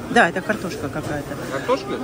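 normal talking voice

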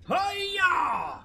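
high loud hi-ya; karate yell. Pretend. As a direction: shout it as you strike. Attack cry in game.

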